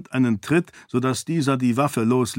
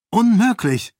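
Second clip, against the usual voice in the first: shocked, much higher shout; cry out; 10 semitones higher, 5 dB louder than usual. A narrator's reading. Sarene ruft dem Priester entsetzt entgegen. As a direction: fling the denial out. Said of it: "rief Sarene".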